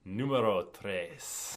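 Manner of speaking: slow, drawn-out "Numero Tres" in a put-on Spanish accent; mock Spanish. Shown as a demonstration. Bass teacher announcing the groove title in mock Spanish accent. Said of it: "I'll say it in English now".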